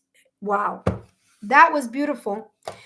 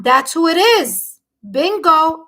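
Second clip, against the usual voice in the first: louder, higher, triumphant; excited confirmation; 5 semitones higher, 6 dB louder than usual. Real, not acted. Psychic reader told she named the right person, excited confirmation. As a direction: call it out like a winning guess.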